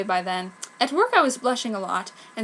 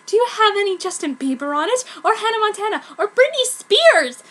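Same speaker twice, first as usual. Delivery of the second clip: rising higher, louder prissy questions; prissy prep; 10 semitones higher, 6 dB louder than usual. Acted. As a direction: play the snooty preppy girl. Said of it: "she said properly".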